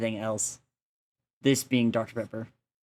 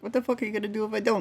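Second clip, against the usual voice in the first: fast, higher mock quote; edited video voice; 8 semitones higher, much faster than usual. Fan quotes the remixed edit of a favourite video, fast mock voice. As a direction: rattle it off like the edited clip. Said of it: "They're like".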